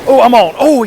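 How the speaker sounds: sudden loud excited call; excited shout. Real, not acted. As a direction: shout it out when the fish bites. Hooks a fish, shouts.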